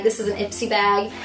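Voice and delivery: usual speaking voice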